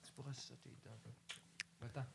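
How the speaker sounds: murmure très bas; chuchoté. Real, not acted. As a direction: souffler ça en aparté. Chuchote à côté.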